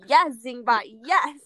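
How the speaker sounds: loud excited yes Zingbot; gleeful cheer of yes. Retold or imitated.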